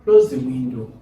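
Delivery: loud CLOSE, falling on WINDOW; falling command. Shown as a demonstration. Teacher giving an example command, falling intonation. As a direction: hit CLOSE hard, then drop away. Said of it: "Carries the falling intonation".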